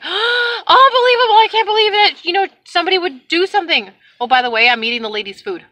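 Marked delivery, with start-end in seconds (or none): 0.0-3.9 high, gushing mock astonishment; shocked sympathy. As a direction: act out a scandalized, sympathetic listener. Acts out third agent's shock.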